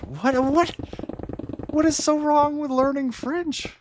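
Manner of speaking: squeaky high mock outrage; mock indignant. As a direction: pretend to be deeply offended. Mock defending French again.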